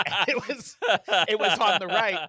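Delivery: real laughter breaking up the words; laughing. Real, not acted. Telling co-host about looking for the leak, laughing. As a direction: tell it through giggles, restarting. Cracking up over own leak story.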